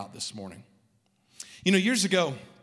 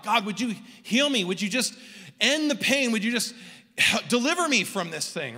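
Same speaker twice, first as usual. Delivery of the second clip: higher-pitched plea, crying out to God; pleading prayer; 6 semitones higher than usual. Retold or imitated. Man recalling years of prayers for healing, pleading. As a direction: beg for relief in a raised, urgent pitch. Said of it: "I cried out to God".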